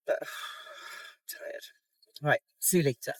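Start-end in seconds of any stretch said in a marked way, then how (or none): 0.1-1.6 whispered sigh of exhaustion; voiceless, drained "tired". Real, not acted. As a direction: let it fall out on a sigh. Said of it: "I'm so tired".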